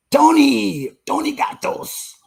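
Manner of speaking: high, drawn-out cartoonish greeting; playful name call. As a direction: sing out the name in a silly high squeal. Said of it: "say your name like that every time".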